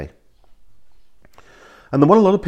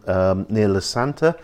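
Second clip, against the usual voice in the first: ordinary delivery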